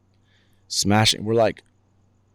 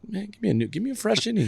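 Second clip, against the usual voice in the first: much higher, whiny mock plea; pleading; 11 semitones higher than usual. Pitcher begging coach for a fresh inning, pleading. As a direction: beg in a raised, imitating voice.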